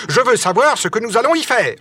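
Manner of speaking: high, insistent and curt. A narrator's reading. Nicole demands answers from his companions, curt tone. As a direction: press the point sharply. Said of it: "d'un ton sec".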